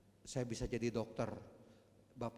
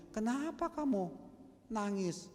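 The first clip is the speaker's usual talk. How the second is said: hint of husband; 10 semitones higher, 4 dB louder, slower than usual. Husband voiced in retold story.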